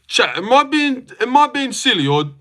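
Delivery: rough attempt at a UK accent; MMA guru. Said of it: "but a guru impression?".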